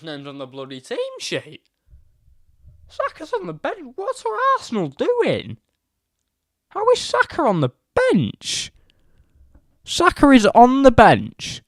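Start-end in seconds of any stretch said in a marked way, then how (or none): 2.9-8.7 baffled disbelief. Real, not acted. Shocked at team news. 9.8-11.6 exasperated exclamation. Real, not acted. Repeating in disbelief.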